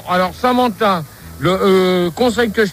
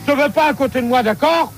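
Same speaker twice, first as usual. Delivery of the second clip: real irritated, higher-pitched order; worked up; 5 semitones higher than usual. Real, not acted. Host sending someone away from beside him, worked up. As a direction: chase someone away, pitch raised in annoyance. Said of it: "c'est terrible quand t'es énervé".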